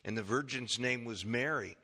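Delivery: unhurried, low pulpit reading of scripture. Acted. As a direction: read the passage solemnly, like a Bible reading. Reads Luke 1 scripture aloud.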